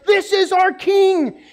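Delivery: high, loud, slow proclamation; crowd cheering. Retold or imitated. Crowd hailing their king, loud and slow. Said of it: "They were like".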